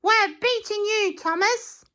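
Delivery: very high teasing kangaroo voice. Acted. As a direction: tease the train as you hop past. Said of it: "said a kangaroo".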